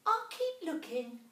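a tiny mouse gasping in wonder; little mouse voice. A narrator's reading.

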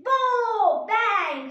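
loud burst sound effect; loud bang. Acted. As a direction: shout the pop of a bursting belly. Frog's belly bursts.

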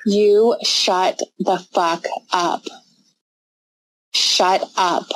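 very slow, controlled command; slow, deliberate warning; angry without yelling. Real, not acted. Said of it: "I'm not yelling".

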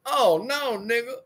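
lightly voiced girlfriend telling him off. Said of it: "and tell him".

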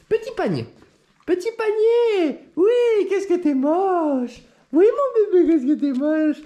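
very high cheerful voice for "moche"; happy high tone. Shown as a demonstration. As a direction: say "qu'est-ce que t'es moche" in a very high delighted voice. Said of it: "selon mon ton de voix".

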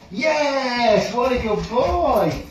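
high, slow drawn-out praise; gushing praise. Real, not acted. Trainer praising the dog from further away, high slow gushing praise. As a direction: stretch the praise out high and happy.

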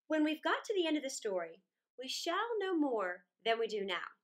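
ordinary delivery

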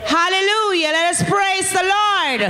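high, sustained hallelujah call; exultant praise call. Real, not acted. Testifier to the congregation before giving thanks, exultant call.

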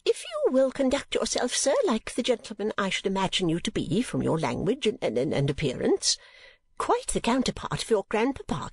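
high-pitched, formal lady's voice; high, stately matronly indignation; prim offended lady. Acted. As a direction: play a lady addressing a stranger with dignity.

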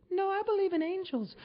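very high falsetto woman; mock female voice. Woman turns down the federale's gun offer, mock female falsetto. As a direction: put on a high girly falsetto.

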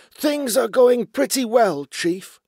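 high, loud, eager-to-please voice; nervous underling voice. A narrator's reading. Basil reporting to his feared chief, high nervous underling voice. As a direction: pitch it up like an anxious yes-man.

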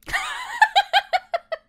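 loud laugh. Acted. Burst of laughter in scene.

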